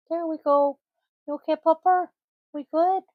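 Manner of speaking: high, sing-song pet voice. Real, not acted. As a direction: coo at the dog. Talking sweetly to the dog.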